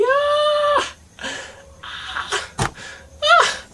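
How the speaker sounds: very high squealing cries; squeaky squeals. Pretend. Vlogger joking about puberty, letting out very high squeals. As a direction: squeal like a voice cracking mid-puberty.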